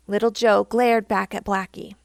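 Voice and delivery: plain reading voice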